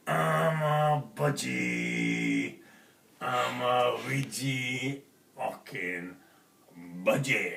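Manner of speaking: a slow, eerie talking-bird voice, drawn right out; creepy budgie voice. Acted. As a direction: speak like a sinister caged bird, very slowly. The caged budgie finally speaks.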